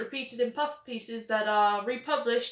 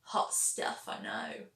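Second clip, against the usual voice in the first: sarcastic whisper; 12 semitones lower, 6 dB quieter, slower than usual. Real, not acted. Sarcastic aside after dull fact.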